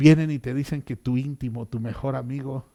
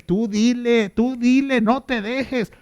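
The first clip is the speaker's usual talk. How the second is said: much higher, urgent goading; egging-on voices; 8 semitones higher, 3 dB louder than usual. Retold or imitated. Voices urge the offended one to fight back, goading. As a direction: mimic busybodies egging someone on. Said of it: "las voces que dicen".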